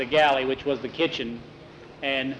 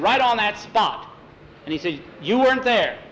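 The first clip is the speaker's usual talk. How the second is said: high, loud accusing tone; scolding; 7 semitones higher, 5 dB louder than usual. A narrator's reading. Tom accuses the boys of leaving the spot, scolding. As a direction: scold them loudly, rising in pitch.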